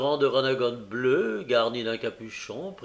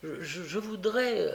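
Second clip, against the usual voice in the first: put-on higher, stuttering child's voice; timid stammer; 6 semitones higher than usual. A narrator's reading. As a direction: stumble nervously on the first word. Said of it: "reprit l'enfant". (bégaiement timide d'une voix aiguë)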